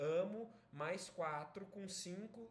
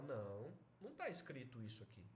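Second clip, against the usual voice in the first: low, soft contradiction; calm wife; 6 semitones lower, 6 dB quieter, faster than usual. Acted. Wife checking the telegram for her husband, calm. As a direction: quietly correct him, unruffled. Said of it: "olha o telegrama e fala".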